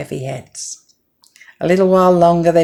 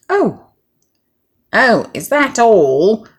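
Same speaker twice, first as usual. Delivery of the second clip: scornful duck, slow and loud; disgust; 7 semitones higher, 6 dB louder, slower than usual. Acted. Duck scoffs at the hen's few grains, disgusted. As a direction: sniff at the tiny find, drawn out. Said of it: "said the duck in disgust".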